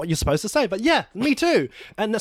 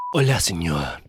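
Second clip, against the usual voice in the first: put-on Spanish accent; 14 semitones lower than usual. Spanish greeting.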